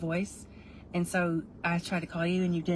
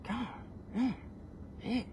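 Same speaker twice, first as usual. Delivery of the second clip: ordinary delivery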